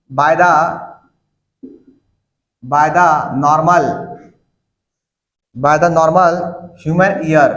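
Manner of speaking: dictating notes with long pauses; slow dictation. Acted. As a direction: spell it out slowly so they can write.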